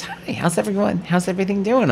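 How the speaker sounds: high, soft attendant greeting; flight attendant. Retold or imitated. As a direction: imitate an attendant's light, polite check-in. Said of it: "the flight attendant comes over".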